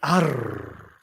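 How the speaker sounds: "Arr" with a long trilled r; rolled r. Shown as a demonstration. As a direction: roll the r in "Arr" hard. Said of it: "rolling my R's".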